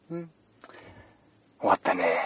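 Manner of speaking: normal talking voice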